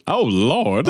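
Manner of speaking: imitated "Oh, Lord", pitched a bit up; drawn-out mock exclamation; male voice from the film. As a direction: quote the astonished background guy. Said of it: "the male voice goes".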